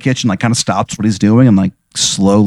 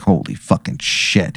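mock startled mutter; 4 semitones lower, 7 dB quieter, slower than usual. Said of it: "He's like".

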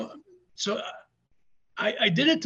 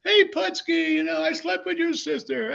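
high, raised-voice fan jeer; imitated shout from the crowd; heckler's taunt; 10 semitones higher than usual. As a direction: call it out like a heckler baiting a wrestler. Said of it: "the guys made another comment".

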